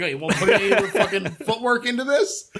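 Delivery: fast, high, laughing through it; laughing mock sneer. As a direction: sneer it out while cracking up. Mocking the inspector's snide remark.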